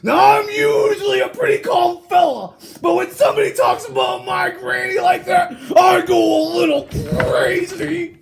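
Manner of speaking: loud riled-up wolf; worked up. Acted. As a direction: get worked up, half laughing. Wolf gets worked up about granny.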